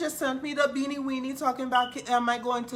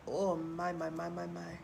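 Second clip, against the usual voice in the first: low and trailing away to almost nothing; fading sigh; 8 semitones lower, 8 dB quieter than usual. Real, not acted. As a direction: let it die out, lower and softer.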